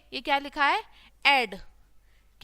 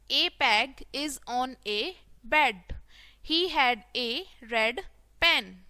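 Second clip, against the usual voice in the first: very slow, separated words; word-by-word reading; much slower than usual. Shown as a demonstration. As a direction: read it out slowly for beginners. Teacher models reading word by word. (धीरे-धीरे, एक-एक शब्द पढ़ना)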